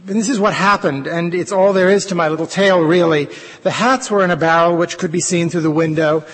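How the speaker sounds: a playwright reading his housewife's monologue, lively and storytelling; a theatrical monologue read with relish; dramatic reading. Acted. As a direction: tell it to the audience like a chatty, wondering housewife. Reads his play's monologue aloud.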